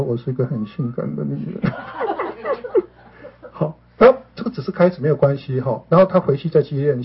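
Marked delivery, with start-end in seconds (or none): 0.0-1.7 shy, half-voiced admission with a laugh; retold small voice. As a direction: mumble it timidly, barely getting it out. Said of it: "他才很小声地说". (小声、害羞地说)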